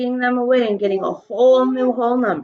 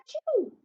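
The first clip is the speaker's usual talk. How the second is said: sneeze; 9 dB quieter than usual. Acted. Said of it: "He went".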